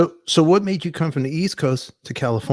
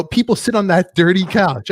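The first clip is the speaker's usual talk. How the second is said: louder, higher mock outrage; indignant retold complaint, pitched up; retold indignation; 5 semitones higher, 5 dB louder than usual. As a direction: protest it in acted exasperation. Acted complaint to show host.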